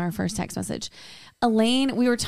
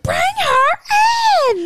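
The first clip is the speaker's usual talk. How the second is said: very high, drawn-out holler; exaggerated slow yell to offstage; impatient shout; 6 dB louder, much slower than usual. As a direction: stretch each word in a loud call. Retold impatient call to bring friend.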